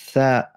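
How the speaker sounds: "Tha" mit Zunge zwischen den Zähnen; "Tha" wie ein gelispeltes T; lispelndes Tha. Shown as a demonstration. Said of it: "so muss die Zunge sein". ("Tha" lispelnd, Zungenspitze sichtbar)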